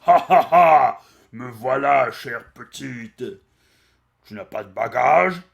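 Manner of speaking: loud booming devil with a laugh; a hammy cartoon villain arriving; pig-nosed devil. Pig-nosed devil greeting his bride, booming and laughing. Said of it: "une espèce de diable qui arrive".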